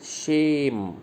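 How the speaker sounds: शेम drawn out slowly with a long "e"; pronunciation demo. Shown as a demonstration. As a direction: stretch शेम into one long syllable. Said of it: "इसको pronounce करना है".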